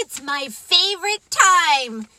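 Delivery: high, loud delighted greeting; excited cheer. Real, not acted. Storyteller greeting listeners at her favorite time, excited.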